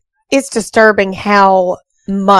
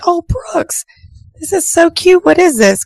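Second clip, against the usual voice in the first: put-on high, sweet cooing over a child's drawing; retold motherly gushing, pitched up; gushing mom; 10 semitones higher than usual. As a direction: coo in a high pitch, imitating a delighted mom. Mom gushing over son's artwork.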